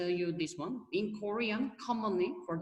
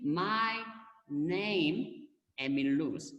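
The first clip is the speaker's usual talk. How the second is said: "my", "name", "Emil Luz" set apart by pauses; "my ... name ... Emil Luz", each piece on its own; word-by-word gloss; much slower than usual. Shown as a demonstration. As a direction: spell out the meaning word by word, pausing after "my" and "name". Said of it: "So simply".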